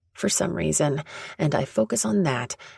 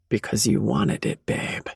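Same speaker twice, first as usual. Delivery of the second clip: a hint of a lower male voice, intimate; 4 semitones lower than usual. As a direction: drop the voice low and close. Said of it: "His voice drops".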